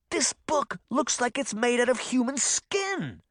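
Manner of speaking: put-on a boy's high, thrilled exclamation; excited exclaim. A narrator's reading. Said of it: "he exclaimed".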